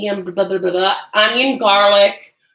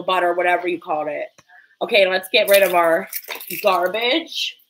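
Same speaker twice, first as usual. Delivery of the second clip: normal talking voice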